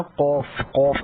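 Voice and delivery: "قوف" twice as a heavy qawf; thick qaaf. Shown as a demonstration. Teacher warning against saying kaaf, thick "قوف". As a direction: q far back with a rounded vowel in "قوف". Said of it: "اس کو کاف نہیں پڑھتے ہیں".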